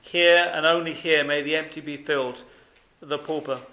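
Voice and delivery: usual speaking voice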